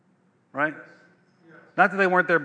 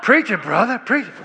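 higher, mock amen-corner cheer; playful call of encouragement, pitched up; egging on a preacher; 3 semitones higher, 3 dB louder than usual. Preacher cheering himself on as he watches himself witness, playfully egging on.

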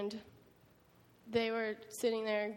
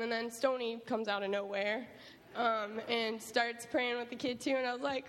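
usual speaking voice

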